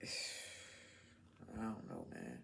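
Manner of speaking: slow, whispered and sighing; very soft, drawn-out sigh. Real, not acted. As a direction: trail off on a tired breath. Weary sigh, giving up.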